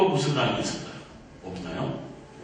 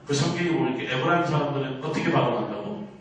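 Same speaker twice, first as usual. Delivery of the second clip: ordinary talk, nothing marked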